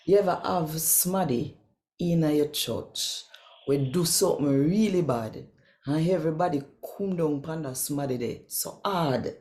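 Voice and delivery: normal talking voice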